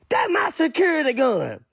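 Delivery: panicked mock cry. [name] telling twelve about the gun, high mock wail. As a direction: wail it high like a mocked snitch.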